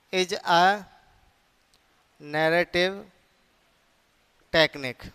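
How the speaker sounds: "is a", long pause, "narrative", long pause, "technique"; word-by-word dictation. Shown as a demonstration. Phrase repeated for writing down.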